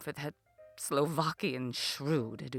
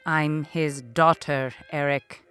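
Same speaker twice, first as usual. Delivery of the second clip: exasperated correction; 7 semitones lower, 4 dB louder than usual. Acted. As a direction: spell it out for a dim brother. Exasperated correction of dim brother.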